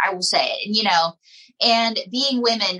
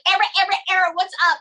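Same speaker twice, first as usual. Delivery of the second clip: high, bright cheerleader greeting called from a car; imitating bubbly friend; 10 semitones higher, much faster than usual. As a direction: call out like a cheery cheerleader driving past. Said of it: "she's like".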